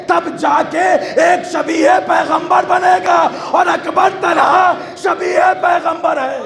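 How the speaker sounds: high, forceful sermon delivery; impassioned preaching. Real, not acted. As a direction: preach it with rising force to the congregation.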